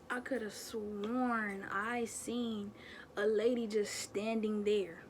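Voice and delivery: drawn-out, spooky slow telling; slow right down for the scary part; creepy storyteller pacing; slow suspense. Real, not acted. Ghost sighting suspense.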